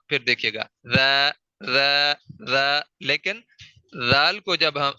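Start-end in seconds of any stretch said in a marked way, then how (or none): 1.0-2.8 "ذا" said thin and light, tongue tip between the teeth; thin light letter. Shown as a demonstration. Tajweed teacher shows students the thin "ذا". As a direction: keep "ذا" fine and light. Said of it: "ذال کو بھی میں ذا کر کے پڑھوں گا".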